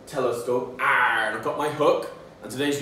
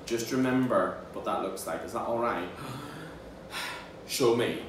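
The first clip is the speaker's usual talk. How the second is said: normal talking voice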